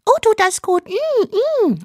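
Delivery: squeaky theatrical moans; moaning dubbed housewife. Acted. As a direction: fake pleasure, hammed up.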